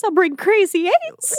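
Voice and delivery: high, slow oddball voice; weird party guest. Acted. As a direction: offer it high and drawn out.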